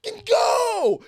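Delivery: high, loud frustrated yelling at a driver; exasperated shouting with laughter breaking in; road rage yell. Retold or imitated. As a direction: scream at the stalled car to move. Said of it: "I'm like".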